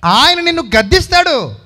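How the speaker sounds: loud and high declaration; preaching emphasis. Acted. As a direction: hammer the point home.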